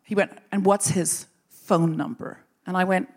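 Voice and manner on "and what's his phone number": slow, pointed interrogator; sinister questioning. Retold or imitated. Elder demanding proof of her story, slow sinister questioning. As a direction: draw out the question with mock menace.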